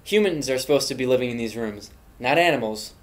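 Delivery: usual speaking voice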